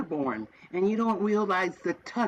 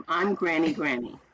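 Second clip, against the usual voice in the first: normal talking voice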